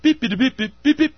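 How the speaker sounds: high, sharp mock beeping tones; imitated beeps. Shown as a demonstration. Host giving the singer a cue with high mock beeps. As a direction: beep like a countdown signal, high. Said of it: "I'll do the beep".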